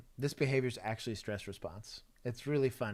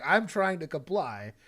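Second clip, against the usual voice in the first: quoting the suspect; 7 semitones higher, 4 dB louder, slower than usual. Retold or imitated. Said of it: "he's like".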